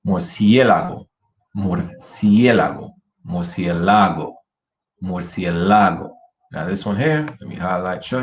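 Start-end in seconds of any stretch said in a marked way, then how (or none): 0.1-2.9 murciélago punched on CIÉ; stress on CIÉ. Shown as a demonstration. Teacher showing students the proper word, stress on CIÉ in murciélago. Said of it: "say this word properly". 3.3-6.1 murciélago wrongly stressed on LA; misplaced stress on LA. Shown as a demonstration. Teacher showing the incorrect pronunciation, stress on LA in murciélago. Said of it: "That's the incorrect pronunciation".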